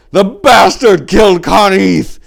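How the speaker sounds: loud, slow anguished wail. Acted. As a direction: wail it out in grief. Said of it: "Urvanor wailed".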